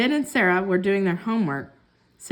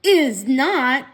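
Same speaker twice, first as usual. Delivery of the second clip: louder stubborn retort; bickering brother; 3 semitones higher, 6 dB louder than usual. A narrator's reading. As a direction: dig in like a stubborn boy. Siblings fight over doll.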